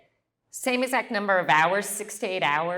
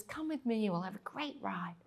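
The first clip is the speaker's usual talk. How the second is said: much softer kind invitation; quietly sweet invitation; soft friendly invite; 2 semitones higher, 11 dB quieter than usual. Retold or imitated. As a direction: offer it gently. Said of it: "she says".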